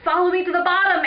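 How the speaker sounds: fast, high, loud yell. Acted. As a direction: yell an urgent order on the run. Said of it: "Eric yelled".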